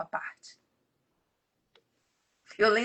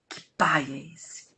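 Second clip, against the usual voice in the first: whisper; 7 semitones lower, slower than usual. Real, not acted. Rhetorical question about a punishing father.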